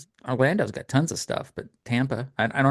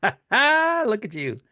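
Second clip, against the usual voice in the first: high, drawn-out teasing through laughter; amused, stretched-out jab; laughing tease; 8 semitones higher, much slower than usual. Real, not acted. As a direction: laugh as you rib your friend. Teasing friend about valet parking.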